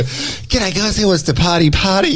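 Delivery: high, slurring party greeting; drunk voice. Retold or imitated. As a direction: play the tipsy host calling out. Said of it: "your drunk voice. You go".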